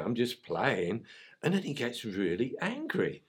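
ordinary talk

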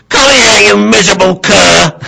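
hammed-up furious scream, high and very loud; owner's scream. Owner screaming at dog to come, high hammed-up yell. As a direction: bellow it as an enraged dog owner. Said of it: "when my owner screams".

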